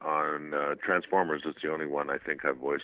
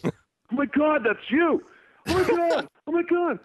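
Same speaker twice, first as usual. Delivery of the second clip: very high, louder mock amazement; gushing retold excitement; starstruck; 4 dB louder than usual. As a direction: imitate an astonished fan. Retold dad recognising the actor.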